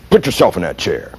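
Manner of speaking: low, ominous order from the boss; stern tone. Retold or imitated. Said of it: "I knew by the tone of voice".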